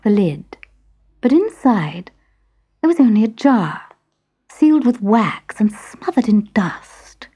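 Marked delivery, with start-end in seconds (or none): none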